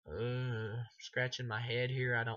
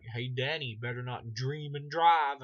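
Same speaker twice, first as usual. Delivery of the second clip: loud, raised, stretched-out villain taunt; Freddy; 3 semitones higher, 8 dB louder, slower than usual. Retold or imitated. Freddy teasing Dan with a pun, loud mock villain voice. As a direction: call it out hammy, dragging the ending. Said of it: "then he's like".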